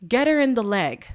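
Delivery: urgent mock shout of an order; acted scream. Evie screaming an order to Roman, mildly yelled. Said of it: "she screams to Roman and says".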